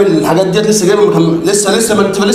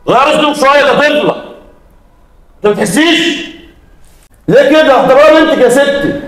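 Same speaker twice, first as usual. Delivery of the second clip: raised, higher voice telling her off; man rebukes a mother for mistreating a child, angry and raised; angry scolding; 7 semitones higher than usual. Acted. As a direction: scold her for how she treats the girl.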